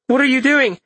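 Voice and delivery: high, loud startled question; alarmed call. Acted. Marissa calls after Corin.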